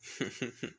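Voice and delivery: soft acted chuckle; joking chuckle. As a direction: chuckle after admitting the joke.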